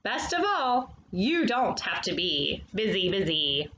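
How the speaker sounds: lively, sing-song picture book reading; reading to children. Acted. Storyteller reading a bee picture book to young children, animated and sing-song. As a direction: read it to the little ones like a storyteller.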